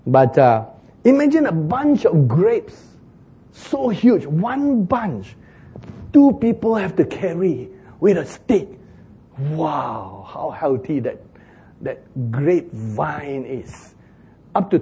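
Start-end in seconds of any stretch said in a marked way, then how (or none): 1.0-8.7 high, slow, wide-eyed marvel; marvelling at impossible grapes; excited wonder. Real, not acted. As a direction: paint the giant grapes with awe. Preacher paints huge grapes vividly.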